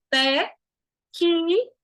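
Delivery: TÉ, QUE as separate drawn-out syllables; sounding out C. Shown as a demonstration. Teacher showing the C is heard, sounded out. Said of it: "percebam que existe o som de C".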